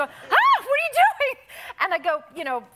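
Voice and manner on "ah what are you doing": high shrieking scream of shock; startled high-pitched scream; retold scream. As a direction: shriek it like you've been caught in the stall. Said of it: "And so I scream".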